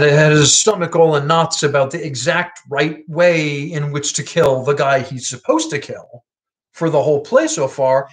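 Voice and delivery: usual speaking voice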